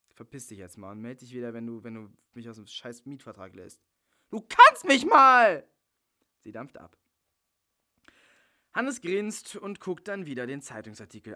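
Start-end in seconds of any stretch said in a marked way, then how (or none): 4.3-5.7 slow, much louder and higher; slam-the-door exit yell; furious parting shout. Acted. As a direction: hurl it at him as you leave. Bella storms off.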